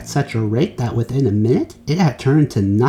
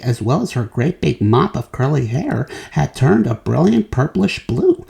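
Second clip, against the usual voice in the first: plain reading voice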